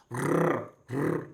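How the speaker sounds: RRR as a long tongue-tip trill; hard Spanish R. Shown as a demonstration. Learner demonstrating the Spanish R, rolled hard. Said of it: "The R should be hard like in Spanish".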